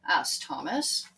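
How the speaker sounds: usual speaking voice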